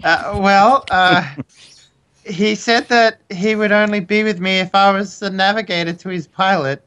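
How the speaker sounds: high-pitched, hesitant woman's voice; put-on woman voice. Sonya explains her love to Katya, put-on woman voice. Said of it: "I need to get into my woman accent".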